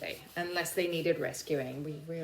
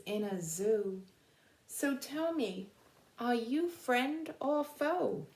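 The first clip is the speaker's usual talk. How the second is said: earnest storybook poem reading, slowing at the end; reading a poem aloud; 4 semitones higher than usual. Acted. Teacher reading the book's closing poem to her class, earnest storybook voice. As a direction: plead with the children through the rhyme.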